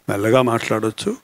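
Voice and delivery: speaking softly to show the volume knob turned down. Shown as a demonstration. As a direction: drop the voice right down to show speaking gently.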